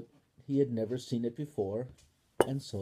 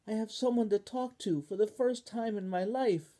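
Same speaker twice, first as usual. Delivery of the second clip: put-on higher, delighted child voice; happy Spoon; 10 semitones higher, 4 dB louder than usual. Spoon tells Ball, higher and delighted. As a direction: glow with joy.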